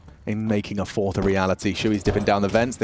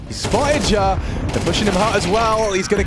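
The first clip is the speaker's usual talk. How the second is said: higher and louder repeat of the call; louder, more excited second call; 9 semitones higher, 3 dB louder than usual. Real, not acted. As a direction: hype the spot up.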